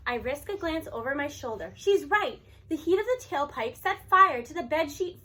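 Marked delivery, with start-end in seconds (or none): none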